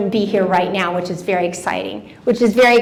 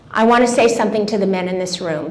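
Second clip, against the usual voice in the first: ordinary talk, nothing marked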